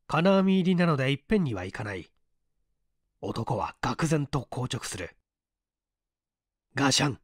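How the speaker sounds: ordinary delivery, nothing marked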